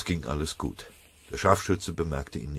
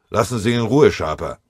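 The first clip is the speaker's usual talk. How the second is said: loud command to back off; firm sergeant's reprimand; sharp order; 4 semitones higher, 8 dB louder than usual. A narrator's reading. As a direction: raise the voice to stop a subordinate. Sergeant stops a soldier striking.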